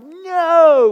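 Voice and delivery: loud, high shout of no; involuntary outburst in the middle of a meeting; sudden yell. Retold or imitated. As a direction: blurt it out at full voice. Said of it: "I yell out".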